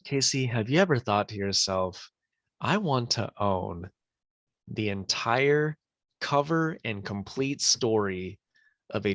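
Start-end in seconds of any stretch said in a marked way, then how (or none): none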